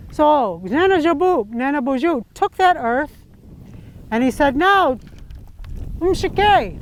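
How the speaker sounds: put-on lively story read to a child, rising and falling; storytelling narration. A narrator's reading. Grandmother telling a legend to her granddaughter, animated narration. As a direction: tell it like a bedtime legend. Tells the creation story aloud.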